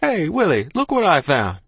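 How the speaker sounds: loud call, starting high and falling; calling a friend over from afar; shout. A narrator's reading. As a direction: holler to show off a find. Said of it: "Then I heard him shout".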